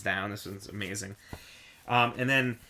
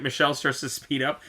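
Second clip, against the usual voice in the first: normal talking voice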